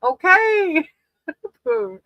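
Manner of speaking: ordinary talk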